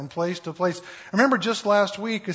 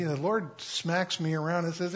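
usual speaking voice

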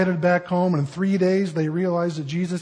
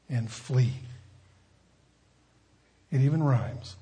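soft, low reflective sermon voice; hushed preaching; 7 semitones lower, 7 dB quieter than usual. Real, not acted. Pastor gently guiding the congregation toward repentance, hushed. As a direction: draw them in with a quiet, intimate close.

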